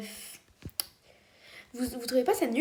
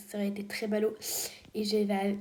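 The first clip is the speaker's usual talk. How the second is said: ordinary talk, nothing marked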